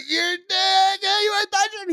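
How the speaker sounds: shrieking accusation through laughter; high laughing yell. Retold or imitated. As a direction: yell it high while cracking up. Friends yelling through laughter.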